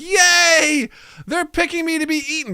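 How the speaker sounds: high, delighted squeal; excited food. Pretend. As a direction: cheer at being chosen. Sausage Party food cheering.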